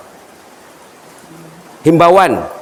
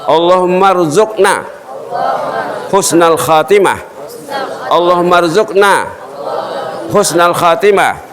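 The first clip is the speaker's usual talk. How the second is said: raised, chanted Arabic supplication; prayer; 5 semitones higher than usual. Real, not acted. Preacher praying for a good end for all listeners, chanted higher. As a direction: lift the du'a up in prayer.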